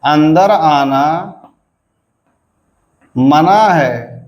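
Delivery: drawn-out "अंदर आना" rising, long pause, "मना है" falls; prohibition tune. Shown as a demonstration. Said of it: "मना का तीन हो गया".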